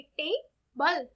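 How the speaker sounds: Table very slowly, with a gap between टे and बल; syllable by syllable. Shown as a demonstration. Teacher showing how table is spoken, table slowly split as टे-बल. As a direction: say टे, wait, then बल for table. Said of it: "table कैसे बोली जाती है".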